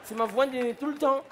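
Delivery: put-on carefree drunk dad. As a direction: toss it off like it's nothing. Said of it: "Mon père, il a dit".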